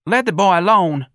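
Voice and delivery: high, loud protest; heckler from the crowd. A narrator's reading. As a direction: call out over a crowd. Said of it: "a voice said from the crowd".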